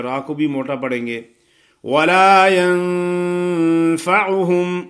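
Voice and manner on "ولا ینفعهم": ینفعهم with a long nasal ن before ف; ikhfa nasal. Shown as a demonstration. Teacher shows ikhfa ghunna on ینفعهم, slow. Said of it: "نون پہ غنہ کرنا ہے اخفا والا".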